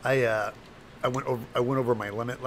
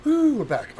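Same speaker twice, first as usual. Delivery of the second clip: high, loud happy whoop; excited cheer; 11 dB louder than usual. Real, not acted. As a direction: cheer that you're back. Cheering return to stream.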